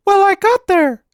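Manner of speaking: put-on high, eager child voice, slowed; a boy bursting with triumph; excited boy. A narrator's reading. Boy to mother after his ride, high and excited. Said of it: "he said excitedly".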